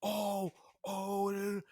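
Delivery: high startled oh; shocked, pitch jumps up; surprised gasp. Retold or imitated. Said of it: "he's like".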